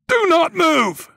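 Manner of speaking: slow, very high shouted command; loud military warning, each word drawn out; screamed order. A narrator's reading. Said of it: "he screamed down at me".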